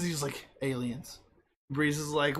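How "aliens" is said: soft, low-key confirmation; understated answer. Retold or imitated. Fandango confirming it to Breeze, understated. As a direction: drop it quietly, as a plain fact. Acted matter-of-fact answer to shock.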